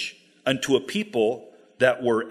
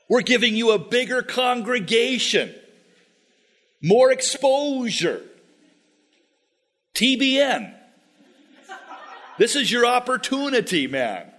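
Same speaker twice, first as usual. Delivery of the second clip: hammed-up sales pitch; 7 semitones higher than usual. Danites pitch a bigger congregation.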